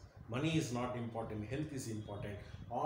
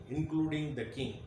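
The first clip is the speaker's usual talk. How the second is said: ordinary delivery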